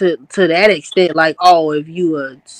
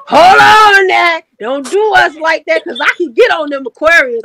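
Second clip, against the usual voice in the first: loud protest; 7 semitones higher, 4 dB louder than usual. Retold or imitated. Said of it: "I said".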